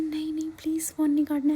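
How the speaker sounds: put-on high, loud little-girl plea; imitating pleading toddler. Aunt imitates niece begging her not to hang up, high toddler plea. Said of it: "ऐसे करके".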